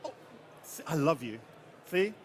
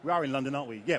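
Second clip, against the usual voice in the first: ordinary delivery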